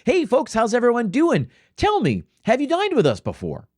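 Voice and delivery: high, chirpy waiter greeting; bubbly server. Retold or imitated. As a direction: gush like an over-eager server. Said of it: "you get some bubbly server walk over and say".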